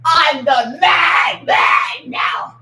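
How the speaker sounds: slow, loud, drawn-out boast; slow shout. Real, not acted. Boasting to the chat, slow and loud. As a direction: stretch it out and belt it.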